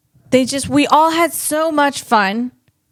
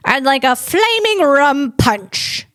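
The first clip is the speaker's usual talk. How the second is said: put-on slow, higher old-movie voice; It's a Wonderful Life character; 4 semitones higher than usual. Quoting a film character for viewers, in a slow old-movie voice. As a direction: order it like a forties bar patron. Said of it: "That's someone else. That's a character from It's a Wonderful Life".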